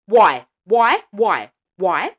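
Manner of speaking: loud repeated demand, fading; exasperated shouting. Real, not acted. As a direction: shout each why, then ease off. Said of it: "I'm so passionate about this".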